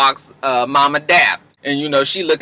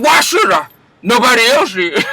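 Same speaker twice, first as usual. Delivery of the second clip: higher, louder mock-defiant mother; defiant Mama Dap; 5 semitones higher, 3 dB louder than usual. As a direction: throw it back at him, high and sassy. Mama Dap defies her son.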